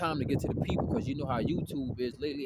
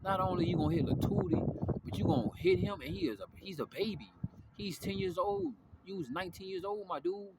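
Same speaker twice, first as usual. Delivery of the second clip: higher, mock-scolding girl voice; scolding girlfriend; 5 semitones higher than usual. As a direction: lightly play the girl telling him off. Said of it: "And she was like".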